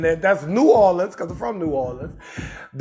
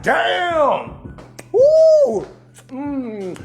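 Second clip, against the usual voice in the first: delighted taste reaction; 5 semitones higher, much slower than usual. Retold or imitated. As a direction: savour it, then squeal with delight. Reacting to tasting the candy.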